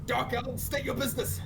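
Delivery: high-pitched armored guard's challenge; commanding challenge. Acted. Armored fighter confronting suspected Dark Elves, commanding.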